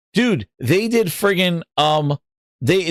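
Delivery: ordinary talk, nothing marked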